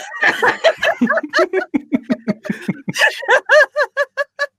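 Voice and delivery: long, high, loud laughing fit; helpless real laughter. Real, not acted. Player who slipped up on the name, real helpless laughter. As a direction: crack up uncontrollably over a blunder. Player blunders, the table cracks up.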